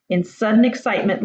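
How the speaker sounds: plain reading voice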